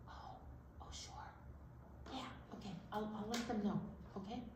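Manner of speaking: quiet secret aside; hushed aside. Acted. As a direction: murmur as if listening. Talking aside to puppet.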